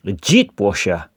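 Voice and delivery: put-on jet washer repeated in a thicker New Zealand accent, jet like chit. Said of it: "Terrible accent, bro".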